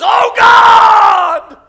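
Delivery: high, full-throated shout held long; desperate cry. Acted. Preacher voicing a mother's prayer, desperate shouted cry. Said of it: "cry that comes out of that well".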